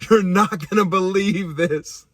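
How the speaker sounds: imitating the thrilled ex; ecstatic. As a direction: ex plays him the message, lightly thrilled. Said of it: "She's like".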